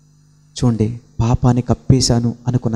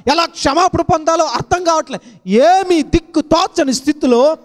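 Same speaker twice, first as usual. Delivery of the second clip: preaching at full force. Acted. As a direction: build the sermon to a ringing peak. (ఉద్రేకంతో బోధించే స్వరం)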